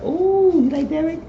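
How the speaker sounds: very high put-on squeal; teasing girly voice. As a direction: tease her about her crush. Woman teasing about a crush.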